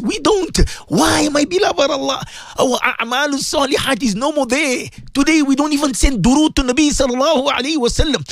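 ordinary talk

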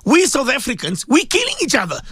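rising, impassioned appeal; passionate outcry. Real, not acted. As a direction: preach it like a warning to the nation.

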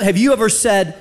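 normal talking voice

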